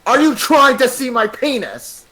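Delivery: retold accusing yell; mock yell. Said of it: "and Brock yelled".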